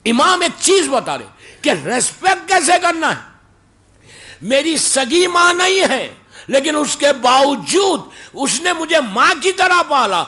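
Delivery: high, loud preaching shout; fiery preaching. Acted. Preacher driving the lesson home to the gathering, full-force preaching. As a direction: preach it hard to the crowd.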